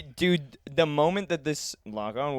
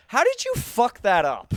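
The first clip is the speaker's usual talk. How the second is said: exasperated; 9 semitones higher, 4 dB louder than usual. Retold or imitated. Retelling exasperated reply to coworker.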